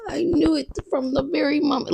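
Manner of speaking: breathy high mock-earnest Jada; Jada Pinkett impression. Quoting Jada Pinkett on pregnancy in a high whisper. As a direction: whisper it like a solemn revelation. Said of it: "unless you're Jada Pinkett".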